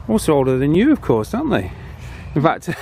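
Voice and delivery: ordinary delivery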